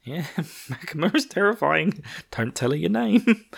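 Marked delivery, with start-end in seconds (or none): 2.3-3.5 mock warning. Quoting a warning line.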